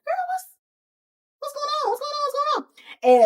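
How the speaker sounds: very high, comic bewildered squeal. Acted. Watching the two of them lock eyes, squeakily baffled. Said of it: "looking back and forth between the two".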